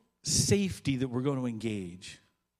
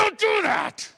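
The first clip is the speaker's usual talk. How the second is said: much higher, loud startled yell; angry shout; 10 dB louder, slower than usual. Acted. Turning on the prankster, a shocked angry shout. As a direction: snap at them in a fright. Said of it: "And you turn around and go".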